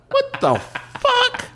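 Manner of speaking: high-pitched, laughing disbelief; shocked outburst. Retold or imitated. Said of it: "And I was like".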